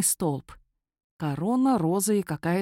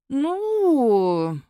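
long, high swooping "nuu"; drawled word; 6 semitones higher, 4 dB louder than usual. A narrator's reading. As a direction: stretch it out appraisingly. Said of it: "протянула Няма". (задумчиво протянуть)

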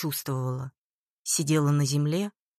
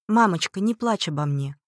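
ordinary delivery, nothing marked

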